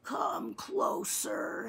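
high, soft, slow rasp; wheezing old man. A narrator's reading. Old man in bed calling the boy closer, frail wheeze. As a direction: beckon feebly, drawing out each word. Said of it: "He breathed with a whistling rattle".

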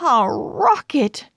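plain reading voice

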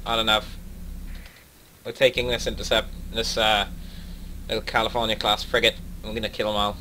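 ordinary delivery

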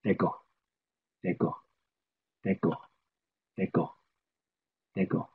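"deko" repeated softly, one at a time; Navajo word drill. Shown as a demonstration. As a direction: drill "deko" gently for listeners. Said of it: "going up is called".

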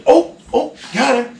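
put-on calm kid quote. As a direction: mimic the kid's offhand catch. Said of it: "he goes".

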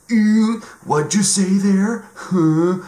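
put-on high, loud, slow swoon at a British accent; mock swooning fan. Mocking Americans charmed by British accents, high swooning coo. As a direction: coo like the accent itself is magic.

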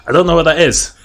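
flustered panic. Retold or imitated. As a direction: blurt it out, lost on the field. Retelling panic on the field.

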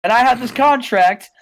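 a swaggering GM with his catchphrase; Teddy Long. Retold or imitated. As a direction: hype it up like a smooth talker. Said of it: "He says, he says".

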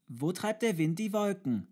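put-on high, loud, insistent child's question; urgent call. A narrator's reading. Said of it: "rief er dringender". (dringend gerufene, hohe Kinderfrage)

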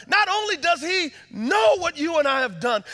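"Not only does he know" preached high and loud; preaching. Acted. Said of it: "repeated line, second louder".

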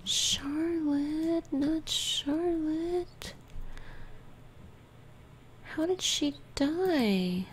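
a stricken no-not-her; dismayed plea. Real, not acted. To the game as Charlotte dies, a high dismayed plea. Dismay at favourite sim dying.

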